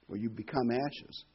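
normal talking voice

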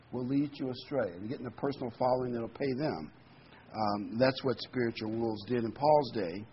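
usual speaking voice

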